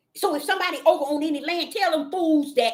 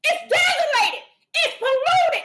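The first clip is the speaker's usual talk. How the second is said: high shouts with a bitter laugh; Father going off; 4 dB louder than usual. Retold or imitated. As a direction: cry it out, laughing in disgust. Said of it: "the Father going off on the land".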